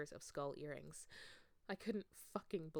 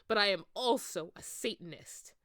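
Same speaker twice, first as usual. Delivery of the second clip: angry declaration; 9 dB louder than usual. Acted. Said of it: "Hagrid paused angrily".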